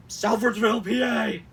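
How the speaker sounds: high, loud place-name announcement; all-caps shout. Acted. Host mocks capitalized town name, shouting. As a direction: bellow it like an arena announcer. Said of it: "without capitalizing all of it".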